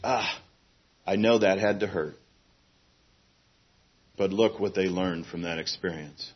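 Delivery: soft, low, gentle voice; tender fatherly comfort. Retold or imitated. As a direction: speak as a loving father consoling. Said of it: "look at us and say".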